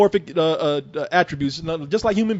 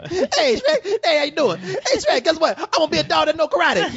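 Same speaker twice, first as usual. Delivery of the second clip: very high, eager cartoon donkey; 7 semitones higher than usual. Donkey tells his ogre friend big news, eager impression. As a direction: pester your ogre pal excitedly. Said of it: "Shrek, Shrek".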